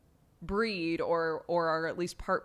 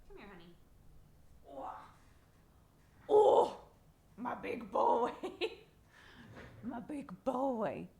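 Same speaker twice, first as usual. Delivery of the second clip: cooing at cat; 5 semitones higher, 3 dB quieter than usual. Real, not acted.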